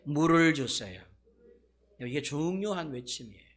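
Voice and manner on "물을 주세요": loud, slow, pleading cry; crying out for water. Shown as a demonstration. Preacher leading the congregation in a loud pleading cry. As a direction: call out for water with the whole room. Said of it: "한번 따라할까요?".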